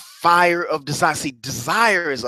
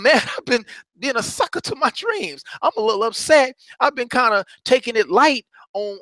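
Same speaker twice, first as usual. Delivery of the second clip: higher, worked-up voice, mad at himself; fed up with his own slacking, pitched up; mock self-frustration, raised pitch; getting kinda mad; 6 semitones higher than usual. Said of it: "you're gonna start getting kinda mad".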